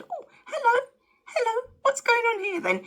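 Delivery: high, squealing mock surprise; mock surprised squeal. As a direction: act startled and coy. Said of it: "And I said".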